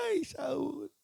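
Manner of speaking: soft, drawn-out mock wail; imitated weeping lament. Preacher acting Samuel crying over Saul, imitated weeping lament. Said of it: "le lloraba".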